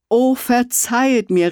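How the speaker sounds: höher, flehender Ausruf; ausgerufen. Acted. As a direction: ruf die Entschuldigung emphatisch hinaus. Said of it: "rief er". (mit erhobenen Händen hoch ausgerufen)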